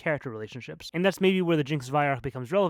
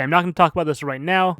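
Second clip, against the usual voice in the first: usual speaking voice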